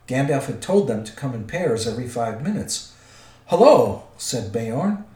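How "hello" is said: big booming hello, higher and louder; loud greeting. Acted. Beorn hails the dwarves arriving, booming. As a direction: boom out a gruff welcome.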